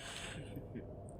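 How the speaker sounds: quiet unvoiced amused laugh; a hushed, knowing chuckle; whispered sly laugh; soft breathy chuckle. Amused at his own spying joke.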